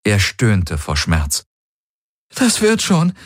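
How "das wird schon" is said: high, choked reassurance; holding back a sob. Acted. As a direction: fight back tears while soothing him. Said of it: "unterdrückte ein Schluchzen".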